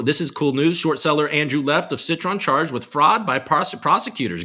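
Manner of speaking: ordinary delivery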